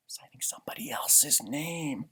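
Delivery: hushed aside to the listener; whisper. Real, not acted. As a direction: whisper it like a secret. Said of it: "reader's conspiratorial aside".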